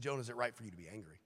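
soft, quick, mild question; gentle God. Acted. God gently asking Jonah, soft quick question. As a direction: ask it softly, almost offhand. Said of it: "He said".

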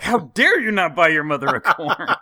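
hammed-up accusing; mock outrage. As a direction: scold the wife in jest. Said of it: "I was like".

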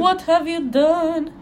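hammed-up tragic wizard line; dramatic Dumbledore. Reading Dumbledore's fanfic line aloud in a hammed-up voice.

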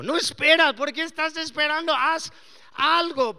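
loud, pushy self urging; urgent self-talk. Retold or imitated. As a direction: push yourself to act now. Pastor voicing his own urging. (urgencia en voz alta)

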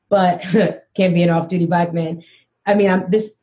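ordinary talk, nothing marked

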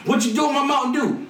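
hammed-up outrage at the doorman; mock angry yell. Player yelling at Spinelli again.

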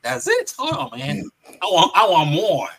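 high-pitched mock outrage at being cut short; retold disbelief turning into loud demand; acted indignant protest. Acting out reaction to the ending.

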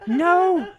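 high, loud imitated wail; mock crying. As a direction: wail it like someone crying, hammed-up. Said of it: "She's crying".

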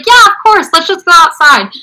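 high, upbeat retold reply; easygoing agreement. Actor agrees to fans' picture request, upbeat and obliging. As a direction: cheerfully wave away any bother.

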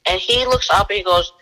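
usual speaking voice

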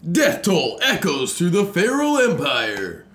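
loud, slow hyped announcer voice; monster truck ad. Pretend. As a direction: bellow it like an arena promo. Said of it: "reads to me like a monster truck ad".

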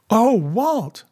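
high-pitched dismayed cry to her husband; instinctive cry. A narrator's reading. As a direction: cry out to him, voice leaping up. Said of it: "was Madge's instinctive cry".

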